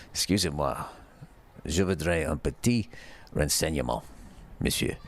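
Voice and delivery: lightly French-accented request, quiet and low; French phrase. Shown as a demonstration. French request to a gentleman, lightly accented. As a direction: say it like a polite French tourist. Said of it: "I learned a new French word".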